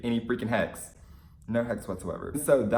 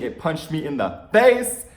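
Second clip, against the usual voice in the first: louder, slow emphatic gush; excited rave; 5 semitones higher, 7 dB louder, slower than usual. Real, not acted. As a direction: hammer home how stunning it was. Raving about talent.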